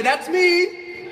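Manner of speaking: loud high call for the crowd to repeat; call to audience. Shown as a demonstration. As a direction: lead the crowd chant.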